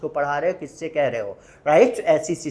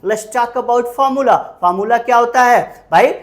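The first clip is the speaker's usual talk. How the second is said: ordinary talk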